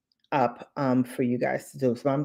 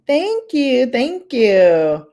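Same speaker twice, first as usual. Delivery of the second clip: excited; 9 semitones higher, 6 dB louder, slower than usual. Real, not acted. Excited thanks to chat.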